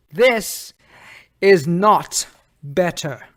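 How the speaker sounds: slow, forceful, indignant verdict; bitter emphasis. Acted. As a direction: punch each word in bitter anger. Bitter verdict on the destroyed world.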